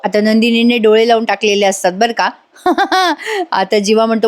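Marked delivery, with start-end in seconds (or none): 2.6-3.5 short high chuckle; brief, high laugh at the story; amused laugh. Real, not acted. Narrator amused by Nandini's tense wait, laughing.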